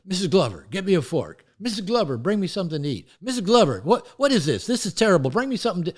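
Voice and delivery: father barking orders. Retold or imitated. Father shouting orders at his wife Missus Glover, loud and demanding. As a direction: play the bossy old-school husband yelling for service. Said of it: "that makes me like my father".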